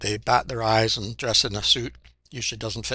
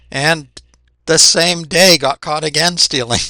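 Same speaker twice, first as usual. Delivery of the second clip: louder and higher, slowing on the punchline; incredulous telling; 5 semitones higher, 11 dB louder, slower than usual. Real, not acted. Reactor telling viewers about a local thief, incredulous and raised. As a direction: land the absurd twist with disbelief.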